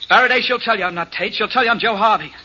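loud, high-pitched excited plea; urgent insistence. Acted. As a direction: insist desperately that she will clear you. Prisoner insists on his identity.

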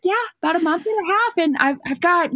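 high, quick breezy reply; breezy nonchalance. Retold or imitated. Pregnant guide answering a shocked client, breezy. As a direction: toss it off high and fast.

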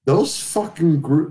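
normal talking voice

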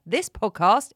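This podcast said loudly, as if in capitals; stress on This podcast. Shown as a demonstration. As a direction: punch This podcast as capital letters. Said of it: "in capital letters".

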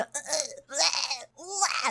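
mock toddler's straining cry, like an old man's growl; imitated old-man-like growl. Teacher mimics toddler crying before pooping, straining old-man growl. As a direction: imitate a crying child grunting with effort. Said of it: "很像老头的那种低吼声".

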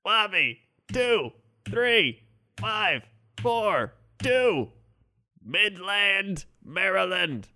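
high, loud, word-by-word machine readout; each word separate, like a glitching robot. Acted. Robot gives weather report.

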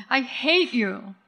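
slow, stretched furious cry; cursing. Acted. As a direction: spit it out at her mother. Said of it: "as the girl kicks and curses".

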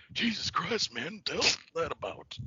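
hushed, husky Jake Roberts voice; Jake Roberts impression. Mocking Jake the Snake reacting to a fan. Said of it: "I do a fantastic Jake Roberts".

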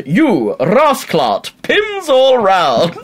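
hammed-up yardie ordering at the bar; mock Jamaican accent. Yardie orders pints for the barman, mock Jamaican accent. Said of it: "Turns to the barman and goes".

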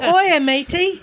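high, slow Australian "Oi, matey"; mock Aussie hello; Australian greeting. Shown as a demonstration. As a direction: put on a hammed-up Australian accent, drawn out. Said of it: "Just start with".

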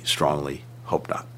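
strongly hope not, dropped to a soft slow whisper. Real, not acted. Official answering the interviewer about next year, in a whisper. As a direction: let the words sink into a whisper of hope. Repeated hope, voice fading away.

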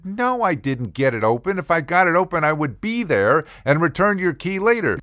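high-pitched exasperated rant; indignant. Retold or imitated. Fuming at what Rick thinks, indignant rant.

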